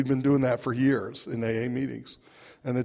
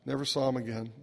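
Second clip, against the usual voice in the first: normal talking voice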